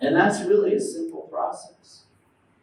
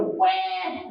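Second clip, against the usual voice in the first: high-pitched imitated baby cry; baby wail; 13 semitones higher than usual. Baby summons everybody, mock wail. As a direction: let out a mock "wah". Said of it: "All I have to do is go".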